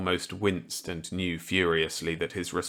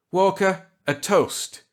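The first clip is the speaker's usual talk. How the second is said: higher, louder voice for kindly Millet; Millet's voice; 12 semitones higher, 6 dB louder, slower than usual. Acted. Millet offering Walker a gambit, kindly. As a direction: play the genial old scientist warmly.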